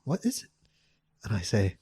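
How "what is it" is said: put-on soft, high voice for the girl; imitated girl. Girl answering his confession request, soft high imitation. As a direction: lightly imitate her, pitched up and gentle.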